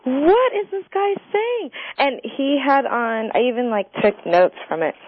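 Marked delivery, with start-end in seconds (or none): 0.0-1.7 high, drawn-out disbelief; incredulous. Retold or imitated. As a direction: stretch it out as an appalled viewer. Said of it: "I was like".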